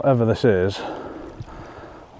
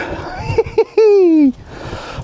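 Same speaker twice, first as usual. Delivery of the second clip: triumphant laugh. Real, not acted. Second fish landed.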